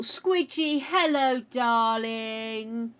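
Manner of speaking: high, cooing voice for the pet; pet talk. Real, not acted. Greeting the dog in a cooing pet voice. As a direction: coo a hello to the pet.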